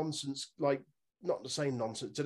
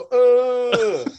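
Master P grunt; 9 semitones higher, 14 dB louder than usual. Retold or imitated. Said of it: "Make him go".

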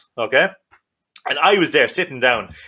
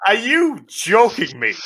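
incredulous; 7 semitones higher, slower than usual. Real, not acted. Outraged at scone with a fry.

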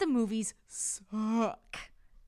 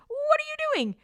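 much higher, louder shout of outrage; mock angry homeowner shouting; indignant yell; 11 dB louder than usual. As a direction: yell him off the roof. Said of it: "looks at him and goes".

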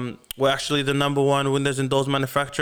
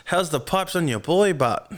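ordinary talk, nothing marked

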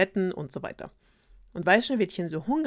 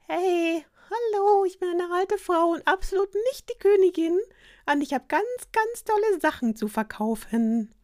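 much higher, louder, sing-song fake old-lady voice; fake old woman; 13 semitones higher, 7 dB louder than usual. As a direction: ham up a sweet old peddler woman. Queen disguised as old woman.

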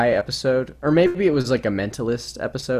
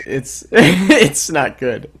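laughing; 11 semitones higher, 6 dB louder, much slower than usual. Real, not acted. Laughing through Boston bit.

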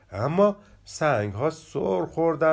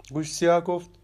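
usual speaking voice

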